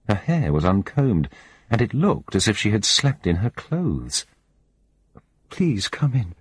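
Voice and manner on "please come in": high, tearful woman barely holding together; shaky voice. A narrator's reading. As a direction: voice trembling on the edge of tears. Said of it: "she said in a shaky voice".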